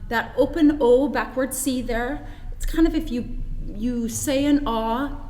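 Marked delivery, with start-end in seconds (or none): none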